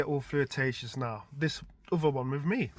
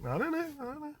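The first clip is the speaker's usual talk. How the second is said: higher, helpless shrug of a voice; mock clueless; 14 semitones higher, faster than usual. Dad answering his son's puzzled look, mock clueless and higher. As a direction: play dumb, lightly voiced. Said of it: "I'm like".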